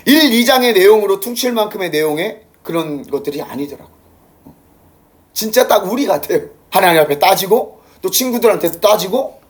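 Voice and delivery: normal talking voice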